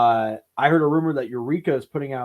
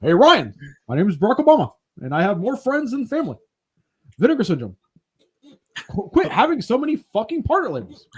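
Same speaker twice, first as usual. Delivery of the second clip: loud, higher, badly faked presidential impression; Barack Obama; 5 semitones higher, 5 dB louder than usual. Host retries a mocked impression for Ryan, hammed-up president voice. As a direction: ham up a shaky political impersonation. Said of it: "That's a terrible Obama".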